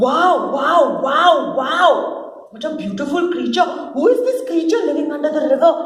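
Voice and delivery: high loud wonder; breathless delight, speeding up; amazed. Acted. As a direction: gush over the beautiful creature. Man amazed at reflection.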